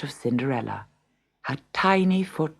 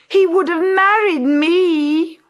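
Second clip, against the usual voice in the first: high, loud, slow crying whine; grizzling; 6 dB louder than usual. A narrator's reading. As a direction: blubber like a spoiled sister. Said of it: "grizzled Gertrude".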